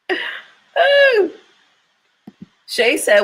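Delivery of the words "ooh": high-pitched "ooh" after laughing; high hoot of amusement; high whoop. Real, not acted.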